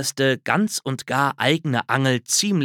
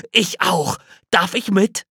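higher, hopeful little squirrel; eager squirrel; 7 semitones higher, 4 dB louder than usual. A narrator's reading. Squirrel asks the bear to join him, hopeful. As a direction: ask to come along eagerly. Hörnchen voiced in story.

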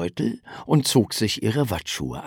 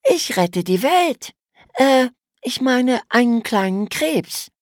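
Mädchenstimme; 14 semitones higher than usual. A narrator's reading. Emma antwortet dem Bruder.

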